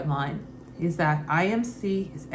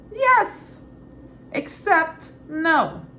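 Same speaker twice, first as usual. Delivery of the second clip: high squeak then slow; 8 semitones higher, 4 dB louder, much slower than usual. Real, not acted. Mock answer, high then drawn out.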